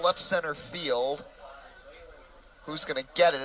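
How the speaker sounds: usual speaking voice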